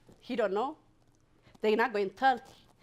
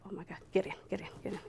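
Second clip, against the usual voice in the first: softer, fading to an urgent whisper; hushed urgency; 12 dB quieter, much faster than usual. Retold or imitated. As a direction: hurry her inside quietly.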